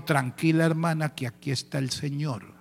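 utterly calm. Retold or imitated. Church leader to screaming mother, utterly calm. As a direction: soothe gently with total composure. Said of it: "con toda esa calma del mundo".

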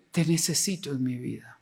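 low, soft and intimate; retold heartfelt confession. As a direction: confide it quietly, as retelling a vulnerable moment. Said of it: "pude abrir mi corazón y decirle". (confesión íntima relatada, en voz baja y suave)

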